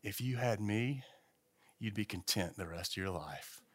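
soft, tempting whisper; whispering bike. Retold or imitated. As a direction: lure him in a hush. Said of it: "that bike whispering to me".

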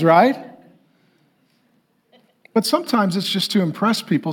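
ordinary talk, nothing marked

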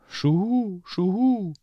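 high, hooting owl cry, then a quick getaway; squawking owl flees in alarm; panicked owl hoot. Acted. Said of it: "rief die Eule".

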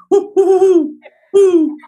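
high, loud owl hooting; female great horned owl hoots. Shown as a demonstration. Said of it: "So she's".

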